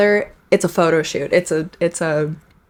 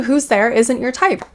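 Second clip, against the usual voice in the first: ordinary delivery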